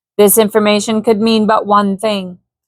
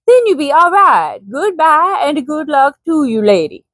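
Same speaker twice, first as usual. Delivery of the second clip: put-on higher Swedish accent, parting kindly; 4 semitones higher than usual. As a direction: Swede saying goodbye in a light accent. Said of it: "didn't do his accent".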